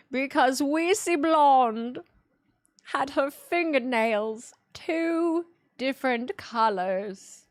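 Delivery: hammed-up fire-and-brimstone prophet; mock doomsday prophet. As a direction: thunder out the plague prophecy like an old-time preacher. Mock doomsday prophecy about Reezy.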